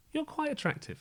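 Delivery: high, soft dreamy compliment; swooning admirer. Retold or imitated. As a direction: swoon over her lightly. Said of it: "and thought".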